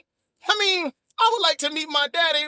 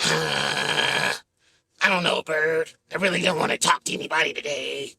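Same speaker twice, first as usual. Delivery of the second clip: very deep, sulky grumble; low and put out, no mood to talk; grumpy Bert; 11 semitones lower than usual. Pretend. Bert refusing to talk to anybody, deep and sulky.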